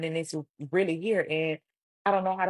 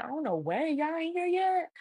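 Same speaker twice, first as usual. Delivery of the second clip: high-pitched incredulous question; disbelief; 11 semitones higher than usual. Retold or imitated. Disbelief mom hasn't arrived.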